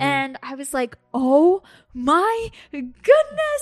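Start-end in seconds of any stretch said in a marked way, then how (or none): 1.1-3.6 amazed. Retold or imitated. Realizing the lion's identity.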